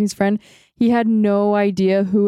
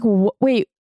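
retold bewilderment, stammered start; lost and confused; 2 semitones higher, much slower than usual. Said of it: "like, so lost".

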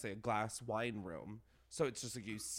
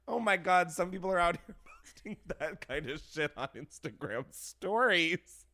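mock scandalized; 6 semitones higher, 8 dB louder than usual. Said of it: "I'm like".

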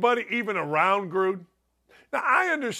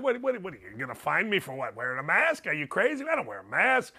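mock-combative Northern Indiana tough talk; Northern Indiana accent; faster than usual. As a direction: argue back like a Gary local, in Northern Indiana accent. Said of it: "Northern Indiana, we go these, them, and those".

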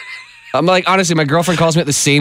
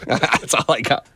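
ordinary talk

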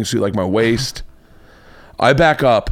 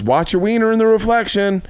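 wife's warning; 8 semitones higher than usual. Retold or imitated. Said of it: "she goes".

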